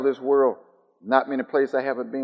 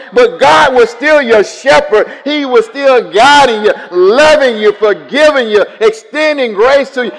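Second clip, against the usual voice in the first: loud, high climactic preaching; preaching at full force; 11 semitones higher, 15 dB louder than usual. Acted. Preacher rousing the congregation to praise, at full force. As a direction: lift the whole room to a shout.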